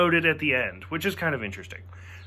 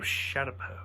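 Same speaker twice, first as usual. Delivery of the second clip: whispered relish; 5 semitones lower, 10 dB quieter than usual. Shown as a demonstration. Savouring the name Shadapo in a soft whisper. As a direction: breathe Shadapo out like a treat. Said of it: "I just like that name".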